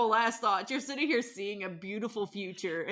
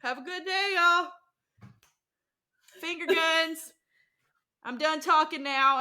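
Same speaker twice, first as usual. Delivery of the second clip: higher, casual and cocky; breezy mock farewell; 4 semitones higher than usual. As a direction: wave them off jauntily. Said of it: "Silver Taints are just walking through the woods like".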